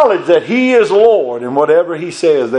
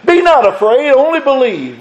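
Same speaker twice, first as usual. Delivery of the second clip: preached refrain; 7 semitones higher than usual. Acted. Repeated verse, preached high.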